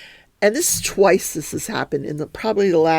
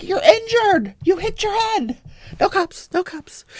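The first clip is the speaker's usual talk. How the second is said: urgent retort; 14 semitones higher, 3 dB louder than usual. Retold or imitated. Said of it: "I'm like".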